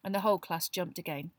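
usual speaking voice

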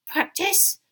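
high, indignant elf squeal; shrill offended house-elf; furious squeal. A narrator's reading. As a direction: squeal it as an outraged question. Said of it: "squealed Winky furiously".

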